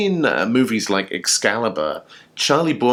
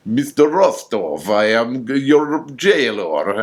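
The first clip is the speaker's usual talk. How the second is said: slow, hammed-up fake Russian accent, pitched a bit higher; mock Russian accent; 3 semitones higher, slower than usual. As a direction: ham up a cheesy movie Russian accent, drawing out the words. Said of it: "terrible Russian accents".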